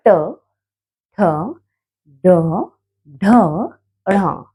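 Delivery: ट ठ ड ढ ढ़ with the tongue curled back; sounding out letters. Shown as a demonstration. Teacher demonstrating retroflex letters one by one. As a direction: sound each with the tongue curling up, one at a time. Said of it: "ऊपर जीभ मुड़ती है बोलते हुए".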